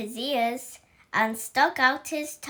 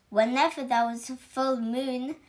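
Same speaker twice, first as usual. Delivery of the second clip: plain reading voice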